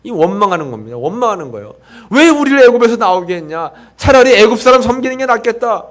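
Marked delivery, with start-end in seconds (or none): 2.1-5.8 higher and louder, an indignant grumble; angry complaining. Retold or imitated. Pastor voices Israelites grumbling at Moses, indignant. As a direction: throw the complaint back at Moses. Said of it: "막 불평하고 원망을".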